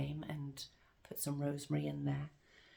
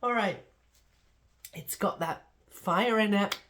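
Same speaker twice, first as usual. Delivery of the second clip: coughing up water; 3 semitones higher, 9 dB louder than usual. Real, not acted. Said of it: "I went down the wrong way".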